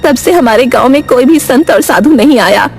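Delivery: high-pitched explaining aunt; village aunt Vimla. Acted. (गांव की काकी की ऊँची आवाज़)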